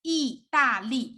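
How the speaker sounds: 意大利 in three emphasized syllables; teacher sounding out 意大利. Shown as a demonstration. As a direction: give each syllable of 意大利 its own beat. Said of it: "break down of each syllable, emphasize it".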